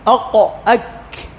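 "أق" with back q, "أك" with forward k and puff; articulation demo. Shown as a demonstration. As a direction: sound out "أق، أك" separately, uvular then velar. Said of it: "القاف فوق والكاف".

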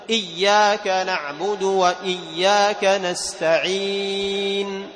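slow chant, jaw dropping only for i sounds; measured recitation with long final ii in نستعين; straight, unchewed recitation. Shown as a demonstration. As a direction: recite إياك نعبد evenly, holding the end of نستعين. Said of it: "لا ينزل هذا الفك".

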